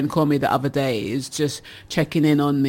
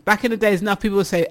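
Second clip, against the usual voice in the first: ordinary delivery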